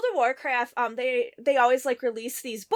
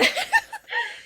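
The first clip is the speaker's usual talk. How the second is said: a sudden high burst of laughter; real laugh. Real, not acted. Laughs at own mockery.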